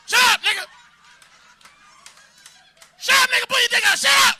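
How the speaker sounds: loud barking mock robber; armed robber. Imagined robber threatening the comic, hammed-up loud barks. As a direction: yell it like a gunman in the bushes. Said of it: "come out of the bush with a pistol".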